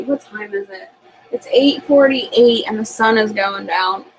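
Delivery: usual speaking voice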